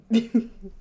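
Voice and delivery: a burst of high, loud laughter. Real, not acted. Laughing after "I'm really sad.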